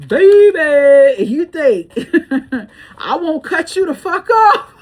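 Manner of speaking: loud, high, breaking into laughter; cackling loud disbelief mid-sentence; laughing. Real, not acted. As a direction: laugh through the threat. Laughing while threatening a cutoff.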